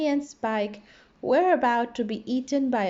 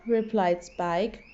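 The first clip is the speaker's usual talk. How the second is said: usual speaking voice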